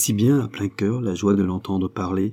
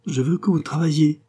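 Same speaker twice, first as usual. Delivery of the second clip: higher, playful sulky demand; pretty pouting tone; 7 semitones higher than usual. A narrator's reading. Angèle tells René to work, in a mild, pretty pout. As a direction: pout at him sweetly. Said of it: "d'un joli ton boudeur".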